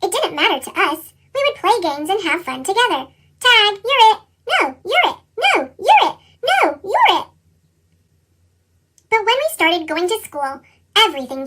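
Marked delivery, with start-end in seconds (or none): 3.4-7.3 put-on high, louder children's game banter; playful kids' tag. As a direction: trade it back and forth like kids playing. Kids playing tag.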